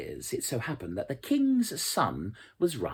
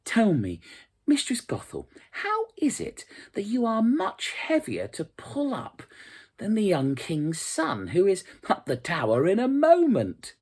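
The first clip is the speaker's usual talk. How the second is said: put-on innocent girl's question; 10 semitones higher, 5 dB louder than usual. A narrator's reading. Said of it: "Rapunzel happened to say to her".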